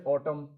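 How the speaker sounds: ऑटम ending on M, no N after it; silent N. Shown as a demonstration. Teacher showing learners silent N, ऑटम ending on M. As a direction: close ऑटम on the M sound. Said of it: "एन silent है".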